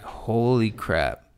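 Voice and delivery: drawn-out exclamation of dismay; retold amazement. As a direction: stretch the words slowly as a recalled reaction. Retells reaction to doctors panicking.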